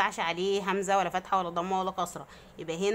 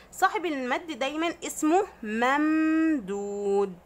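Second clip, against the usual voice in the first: slow emphasis; 4 semitones higher, slower than usual. Shown as a demonstration. Teacher slowly naming the term.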